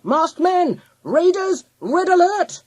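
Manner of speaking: high, loud bursts of warning; urgent guard-dog hollering; yelled alarm. A narrator's reading. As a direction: shout each alarm like a barking dog. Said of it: "he yelled".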